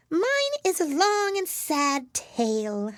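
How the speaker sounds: high, slow little mouse voice, sorrowful; sighing mouse. A narrator's reading. Mouse tells Alice its sad tale, high mouse voice. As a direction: draw it out like a sad tiny mouse. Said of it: "turning to Alice and sighing".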